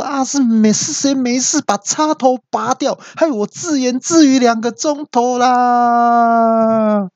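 very high, drawn-out mock scream; furious yelling. Dad learns radio was unplugged, furious yell. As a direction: wail in exasperated outrage. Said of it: "我就气到大叫说".

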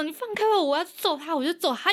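put-on angry child. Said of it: "他就说".

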